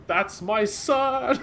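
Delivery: high, slow, laughing through it; a beaming father quoted with a laugh; laughing mock proud dad. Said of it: "He's like".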